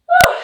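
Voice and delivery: a short puffed-out exhale of relief; relieved whew. Real, not acted. Yoga teacher catching her breath after backbends, relieved whew. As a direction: blow out the breath after effort.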